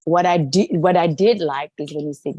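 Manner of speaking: ordinary talk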